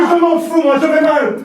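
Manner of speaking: high, loud outburst; panicked shout. Acted. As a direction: yell back in distress. Theatre owner panicking, yelling back.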